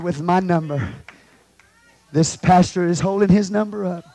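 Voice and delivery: usual speaking voice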